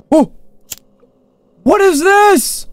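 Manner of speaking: stunned by the surprise; real alarmed question; shocked cry. Real, not acted. Sudden new horror section.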